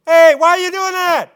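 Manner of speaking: high, loud mock yell at his sons; a hammed-up father's yell; mock shout. Eli's feeble correction acted out.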